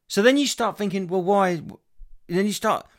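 rising irritation at the cafe; exasperated rant. Real, not acted. Ranting about locked Wi-Fi.

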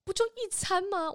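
high disbelieving scoff; can't believe it's one meal; mock incredulous retort. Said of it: "我就想说".

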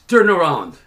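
loud, firm command; retold police order. Officer ordering the man to turn, loud command. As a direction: bark it as an officer's order, falling at the end.

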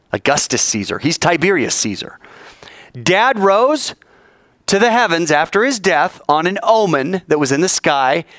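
normal talking voice

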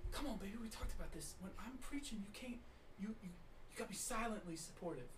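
soft, fast hushed rebuke; hushed scolding. Acted. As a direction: scold her under your breath. Pastor hisses to wife quietly.

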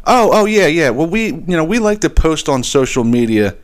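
high, airy mock voice of the flustered rep; mimicking the customer rep's lame excuse, mocking; mocking her excuse. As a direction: parody her, starting high and trailing down. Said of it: "She comes back with".

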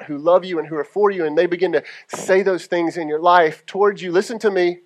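ordinary talk, nothing marked

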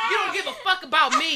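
loud mock drunken outrage; acting out a partner's shouted grievance; imitated angry accusation. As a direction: throw the blame out loudly. Voicing boyfriend's drunken angry accusation.

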